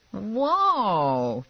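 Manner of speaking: long, high-pitched whoa; impressed. A narrator's reading. Man seeing her holster and gun, impressed. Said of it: "clearly impressed".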